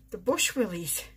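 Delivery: usual speaking voice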